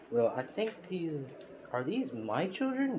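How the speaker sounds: slow, then rising high in disbelief; stunned surprise. Acted. Deku discovering his children, slow and rising in disbelief. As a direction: Deku can't believe these are his kids.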